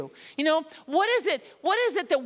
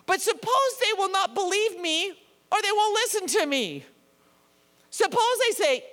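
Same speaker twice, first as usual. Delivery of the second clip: Moses pleading; 6 semitones higher than usual. Acted. Preacher voicing Moses pleading God.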